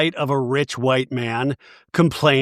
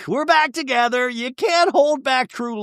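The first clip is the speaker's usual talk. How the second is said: swooning gush; 9 semitones higher than usual. Retold or imitated. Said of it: "Brittany goes".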